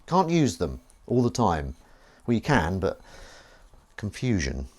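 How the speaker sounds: ordinary talk, nothing marked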